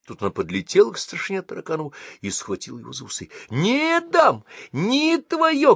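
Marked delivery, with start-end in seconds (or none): 3.5-5.7 much higher, frantic screaming; screaming like mad. Acted. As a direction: howl it like a madwoman. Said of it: "как сумасшедшая орала она".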